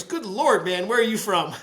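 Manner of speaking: imitated counterman's exasperated outburst; startled deli counterman. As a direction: blurt it at the customer in mock amazement. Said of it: "the guy from the counter".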